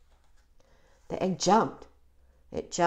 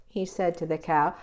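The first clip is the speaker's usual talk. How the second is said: usual speaking voice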